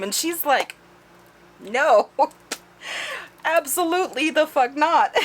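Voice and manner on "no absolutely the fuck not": high, loud and laughing; flat-out no, laughing; emphatic refusal. Retold or imitated.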